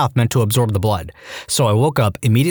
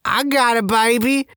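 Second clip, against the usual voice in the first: put-on [name] accent; 11 semitones higher, slower than usual. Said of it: "Think [name] type accent".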